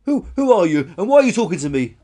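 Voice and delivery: high, loud stammered demand; startled challenge. Acted. Crying dinosaur questions a stranger, startled and loud. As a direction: blurt it out, taken aback.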